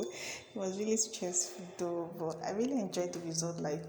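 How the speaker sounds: ordinary talk, nothing marked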